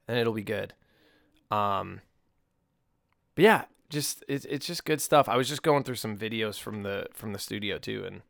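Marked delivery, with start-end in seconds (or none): none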